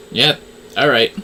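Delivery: ordinary talk, nothing marked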